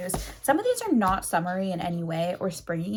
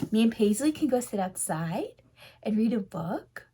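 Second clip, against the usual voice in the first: doggy voice. Acted. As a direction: talk like a cartoon puppy to your dog. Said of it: "'cause I use my doggy voice".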